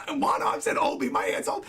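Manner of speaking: words squeezed out through real laughter; laughing while talking. Real, not acted. Streamer retelling what Reagan said about holding Obi, cracking up.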